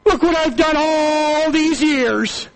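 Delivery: lightly voiced complaining son, high and loud; a touch of hurt resentment; resentful son. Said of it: "And says".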